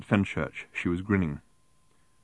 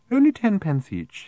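much higher, prim sales patter; prim raffle seller; 11 semitones higher, 4 dB louder than usual. A narrator's reading. Woman offering tickets to Arthur, prim and coaxing. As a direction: pitch it high and prim.

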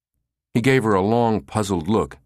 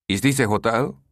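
higher, stuffed-up caller voice; nasal with a cold; 4 semitones higher than usual. Acted. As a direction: sound like you have a slight cold. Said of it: "He sounded nasal, like he had a slight cold".

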